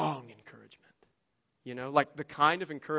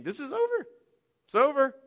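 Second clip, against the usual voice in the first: it's all lost; despairing disciples; 10 semitones higher than usual. Retold or imitated. Despairing disciples after crucifixion.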